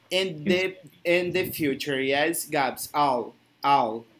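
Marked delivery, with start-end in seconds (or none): 2.9-4.1 "all" with a clearly sounded final L; teacher models the L in "all", lower; L pronunciation demo. Shown as a demonstration. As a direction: isolate "all, all", holding the L. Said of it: "There is this L".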